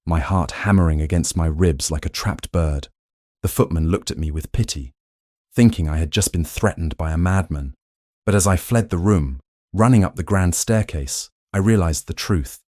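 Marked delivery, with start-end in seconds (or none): none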